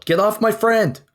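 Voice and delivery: high-pitched battle yell; in-character yell. Acted. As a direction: yell in character to defend a friend. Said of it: "And I just yell".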